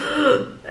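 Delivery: sudden startled gasp; sharp gasp. Retold or imitated. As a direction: gasp like waking underwater. Waking with face underwater.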